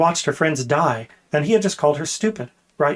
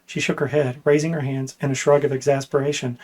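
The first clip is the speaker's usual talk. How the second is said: usual speaking voice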